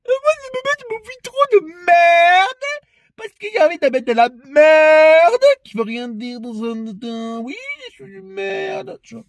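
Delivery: squeaky mock impression of a politician; mocking Macron. Mocking the president to viewers in a high put-on voice. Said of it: "c'est Macrotte, là, qui fait".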